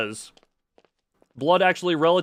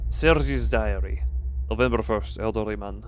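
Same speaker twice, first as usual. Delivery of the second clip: lowered, measured reading of a diary entry; 7 semitones lower than usual. Acted. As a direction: read the note low and steady like a journal. Reading diary notes aloud.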